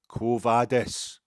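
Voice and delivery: loud, drawn-out slow yell; slow, loud mock heckle of the man in the cinema; heckler's shout. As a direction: bellow it slowly across the theatre. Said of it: "he just started going".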